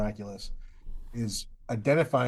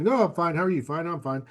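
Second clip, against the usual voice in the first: quick mock pleasantries, starting high and loud; polite niceties; 6 semitones higher, 4 dB louder than usual. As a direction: parody a rote exchange of "fine". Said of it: "the polite niceties that society says we should".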